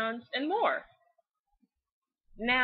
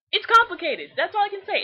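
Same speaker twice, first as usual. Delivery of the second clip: louder, higher, exasperated; flustered outburst; 11 semitones higher, 10 dB louder than usual. Real, not acted. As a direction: throw up your hands, can't explain. Flustered about Lyra.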